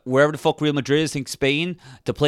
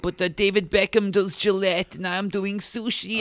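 put-on Mexican accent; 7 semitones higher, 4 dB quieter than usual. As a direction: play Santiago sulking in a mock Mexican accent. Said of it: "he's like".

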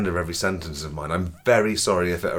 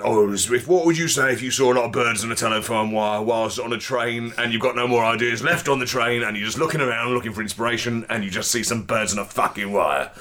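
normal talking voice

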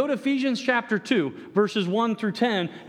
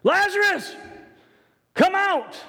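high, loud call to the tomb; loud cry; 11 semitones higher, 3 dB louder than usual. Acted. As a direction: cry out Lazarus with full force. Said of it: "he cried out with a loud voice".

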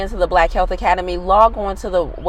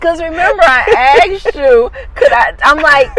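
high, breaking with laughter; laughing hard; 13 semitones higher, 6 dB louder than usual. Real, not acted. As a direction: squeeze the words out through giggles. Laughing through the honey confession.

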